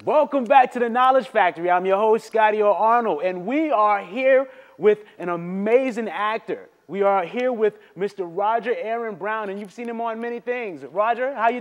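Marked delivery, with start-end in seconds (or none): 0.0-9.5 loud, upbeat host welcome; show host intro. Acted. Host welcoming viewers back to his show, upbeat announcer voice. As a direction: pump up the show opening.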